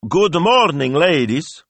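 high, loud priestly hail; hearty shout across the square; shouted greeting. A narrator's reading. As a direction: call out to the approaching ladies. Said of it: "Don Rocco shouted".

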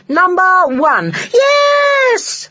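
very high, loud cheer; long, shrieked celebration; excited shouting. A narrator's reading. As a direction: yell it like ecstatic children. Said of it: "shouted the children".

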